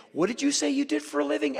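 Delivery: high, prying official's voice; suspicious minder. Retold or imitated. North Korean minder pressing the tourist about his job, high and prying. Minders questioning him daily.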